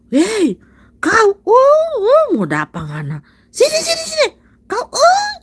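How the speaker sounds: very high, loud provoking yell; loud, high-pitched rough Sulawesi shout; blunt shouting. Retold or imitated. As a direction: challenge him to come fight. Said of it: "ceplos-ceplos".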